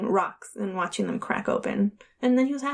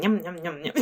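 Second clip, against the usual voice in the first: put-on low, nibbling baby babble; munch the words like a toddler; lightly imitated little one's yum-yum; imitating toddler; 5 semitones lower, 3 dB quieter than usual. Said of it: "he was going around the table going".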